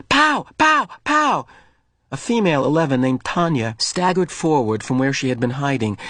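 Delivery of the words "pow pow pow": high, punchy pow sounds; child firing an imaginary gun; play gun noises. Acted. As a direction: fire a pretend gun. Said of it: "A child's voice ... made the sound".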